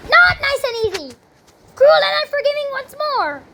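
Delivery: high-pitched wail of complaint; exasperated cry. Real, not acted. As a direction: cry out in dismay at the game. Player failing in hard level.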